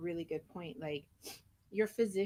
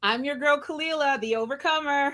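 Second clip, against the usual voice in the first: louder, higher proud self-introduction; proud sign-off; 5 semitones higher, 8 dB louder than usual. Real, not acted. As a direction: announce yourself with pride.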